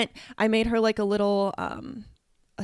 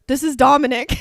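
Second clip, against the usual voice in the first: laughing; 4 semitones higher, 9 dB louder than usual. Real, not acted. Laughing punchline.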